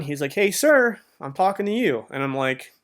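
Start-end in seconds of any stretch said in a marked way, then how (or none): 0.3-2.1 high-pitched mock kid's call; mild mock yell, high; imitated kid yelling. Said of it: "this kid starts yelling".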